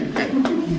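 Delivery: a loud, high burst of real laughter. Real, not acted. Narrator laughs at the Duchess.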